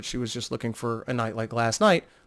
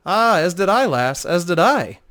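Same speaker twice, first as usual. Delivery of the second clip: raised, slowed-down bardic lilt; a smooth old minstrel savouring the moment; charming minstrel Talon; 5 semitones higher, 5 dB louder, slower than usual. Acted. Talon agreeing with Vo, in a charming minstrel voice.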